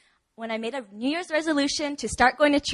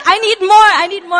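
much louder and higher, giddy and craving; eager hunger; 9 semitones higher, 14 dB louder than usual. Retold or imitated. Signing up for every group, a loud excited craving. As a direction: beg for more like an excited kid.

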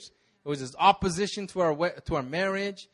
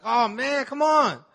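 higher, louder fed-up groan of complaint; exasperated; 8 semitones higher, 4 dB louder, much slower than usual. Retold or imitated. Driver hears pastor forgot passport, exasperated high whine. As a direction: whine it like the day just got ruined.

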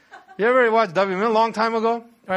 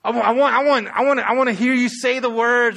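stammering with eagerness, loud and raised; acted excited plea; eager, impatient demand; 6 semitones higher, 7 dB louder than usual. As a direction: trip over the words, bursting to hear it. Spirit eager like tag partner.

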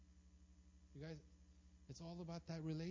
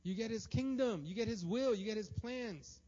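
climactic preaching; 5 semitones higher, 9 dB louder than usual. Acted. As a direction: build the crowd up line by line.

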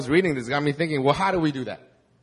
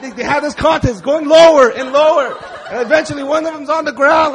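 laughing; 11 semitones higher, 8 dB louder than usual. Real, not acted. Laughing retelling the contest.

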